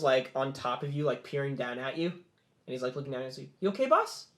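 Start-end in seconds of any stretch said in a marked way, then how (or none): 3.6-4.3 high-pitched sidekick voice; Percival. Acted. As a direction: pipe up high to check on the boss. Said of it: "And Percival's".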